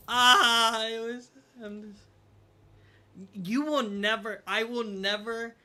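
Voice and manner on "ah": pained groan. Real, not acted. Groaning over his cringy intro.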